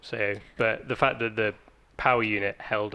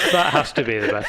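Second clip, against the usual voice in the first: laughing; 6 semitones higher, 5 dB louder than usual. Real, not acted. Laughing at the joke.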